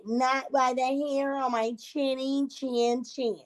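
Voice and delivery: slow, lightly put-on little pig voice; pig's refusal. Little pig refusing the wolf in a slow mild pig voice.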